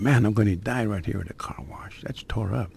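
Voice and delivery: breathy voiceless whisper to himself; hushed private dread; whispered thought. Retold or imitated. Fearful inner thought at car wash.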